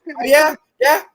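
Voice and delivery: put-on high-pitched, heated Boston accent; Bill Burr. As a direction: gripe in a mock Boston accent. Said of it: "that Boston accent".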